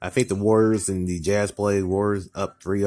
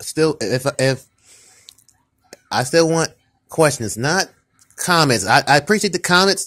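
louder, higher, fed-up ranting at viewers; upset rant; 5 semitones higher, 5 dB louder than usual. Real, not acted. Vlogger scolding viewers for not asking questions, upset and loud. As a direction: let the frustration spill out as you scold. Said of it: "Sorry, I'm a little upset".

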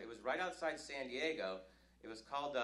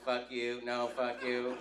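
louder, lower robotic squabble; two machines trading insults; mock robot argument; bickering cars; 4 semitones lower, 7 dB louder, slower than usual. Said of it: "the cars are like".

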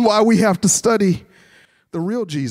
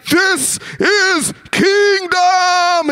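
high, slowly stretched war cry; mock battle cry; 10 semitones higher, much slower than usual. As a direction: draw each word out like an epic hero. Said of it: "if Jesus was like".

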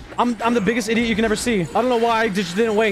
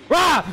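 mock roar; 7 semitones higher than usual. Real, not acted. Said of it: "I scared you first".

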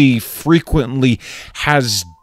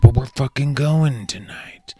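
whisper; 4 semitones higher than usual. Acted. Repeated phrase in whisper.

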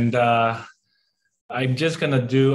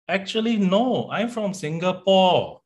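higher, correcting protest; high indignant reply; 7 semitones higher than usual. Retold or imitated. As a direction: insist it, pitched up high.

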